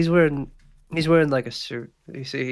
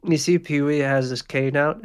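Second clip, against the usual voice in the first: ordinary talk, nothing marked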